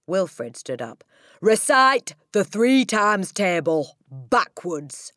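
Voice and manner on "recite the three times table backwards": slow, loud, raised command; barked order. Acted. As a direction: bark it at the pupil, word by heavy word. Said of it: "the Trunchbull barked".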